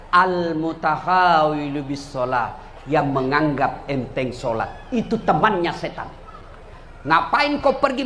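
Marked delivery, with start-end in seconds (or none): 0.1-4.9 slow Iblis voice. Acted. Iblis naming his friends.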